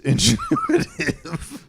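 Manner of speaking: high, slow, laughing through it; laughing tagline. Acted. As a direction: giggle out the slogan.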